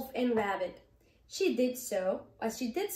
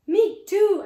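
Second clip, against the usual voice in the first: scared exclaim; 8 semitones higher, 12 dB louder than usual. Acted. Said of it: "added Beaver".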